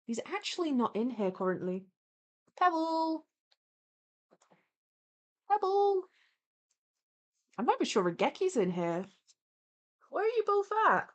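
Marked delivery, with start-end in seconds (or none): 2.6-6.1 high call for the cat. Real, not acted. Calling cat. 10.1-11.1 high calling voice. Real, not acted. Calling for cats.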